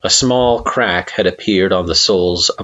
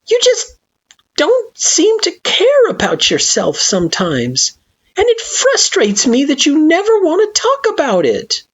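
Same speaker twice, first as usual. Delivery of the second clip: frustrated plea; 3 dB louder than usual. Acted. Frisk frustrated.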